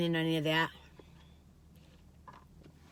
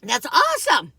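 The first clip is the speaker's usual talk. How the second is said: loud, bright burst of praise; excited praise; 6 semitones higher, 9 dB louder than usual. Retold or imitated. Praising aged aunt's swimming, loud and excited. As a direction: cheer her on, really impressed. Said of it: "I was like".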